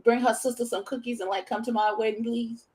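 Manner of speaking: mock sweet pleading. Inviting the wronged woman's sister to a wedding, mock sweet. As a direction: mockingly play the innocent, asking nicely.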